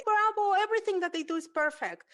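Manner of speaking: high, loud over-the-top praise; mock gushing parent. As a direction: gush like an overproud parent. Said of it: "I hate the parents that they say".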